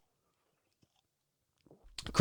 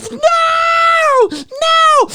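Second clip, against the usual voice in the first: high shrieking no; terrified high-pitched scream; Laurie screaming; 3 dB louder than usual. Pretend. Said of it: "Laurie's going".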